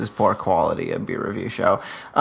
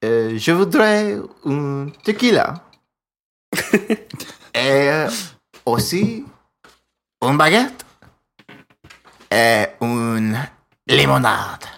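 put-on French accent; 2 semitones higher than usual. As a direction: order at a café in fake French. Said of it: "Can you say tequila with a French accent".

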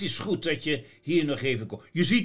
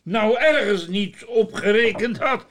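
laughing; 9 semitones higher, 6 dB louder than usual. Acted. Sinterklaas laughing, asking for water.

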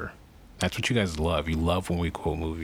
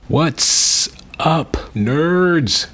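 shouted hype intro, much higher; loud hype greeting; 11 semitones higher, 13 dB louder, much slower than usual. Acted. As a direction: belt it out like a hype man.

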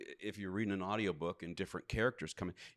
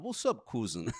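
put-on Russian accent. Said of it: "Russian accent".

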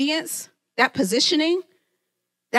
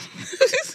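real high-pitched laugh at herself; 13 semitones higher than usual. Real, not acted. Laughing at herself mid-sermon, high laugh. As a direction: laugh at your own tantrum. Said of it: "I'm laughing".

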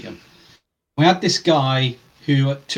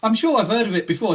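put-on Welsh accent; 8 semitones higher than usual. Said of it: "He had a broad Welsh accent going".

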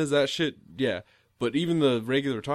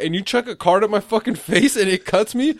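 mock angry rant; 8 semitones higher, 5 dB louder than usual. Angry rant about card to face.

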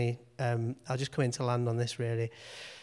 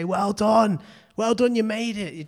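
mock cheer, higher and louder; cheering welcome; 6 semitones higher, 7 dB louder, slower than usual. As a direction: call it out like saints at the finish line. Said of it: "will be shouting and cheering".

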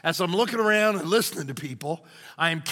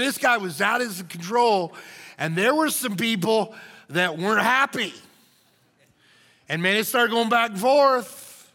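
ordinary talk, nothing marked

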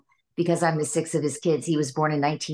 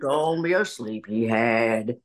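low, slow puppet voice; Allie; 6 semitones lower, slower than usual. Retold or imitated. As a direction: drag it out as a deep-voiced hand puppet. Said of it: "And then Allie would go".

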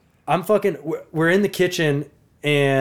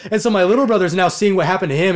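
ordinary delivery

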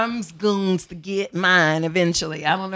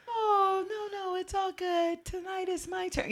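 much higher, soft sugary falsetto; sweet accommodating voice; 12 semitones higher, 8 dB quieter than usual. Retold or imitated. Host mimicking a sweet giving woman, high. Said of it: "We're gonna be like".

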